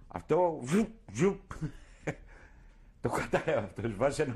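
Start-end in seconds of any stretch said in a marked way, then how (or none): 0.1-1.4 higher, sharp mock zip of a running belt; imitated machine whoosh. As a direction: imitate the belts spinning. Imitating the lathe belt noise.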